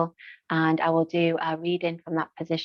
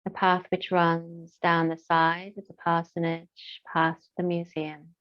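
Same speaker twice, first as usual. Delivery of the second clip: guided meditation. Acted. Yoga teacher leading listeners through breath and moorland, guided meditation. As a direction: guide them gently into stillness, phrase by unhurried phrase.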